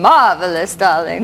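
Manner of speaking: low, put-on posh British; posh British accent. Shown as a demonstration. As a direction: echo the coach in posh British. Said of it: "You go around and say".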